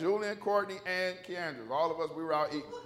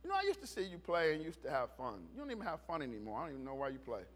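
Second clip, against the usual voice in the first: lightly voiced disappointed mom; understated, low-key telling-off; mom's quiet reproof; 4 semitones lower, 6 dB quieter, faster than usual. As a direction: say it softly, letting it sink in. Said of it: "and she goes".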